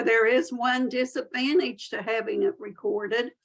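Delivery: normal talking voice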